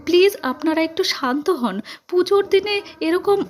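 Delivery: high-pitched, distressed appeal; pleading. A narrator's reading. Young woman begging the family to calm down, pleading.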